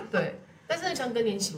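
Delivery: high laugh running into words. Real, not acted. Laughing at own story.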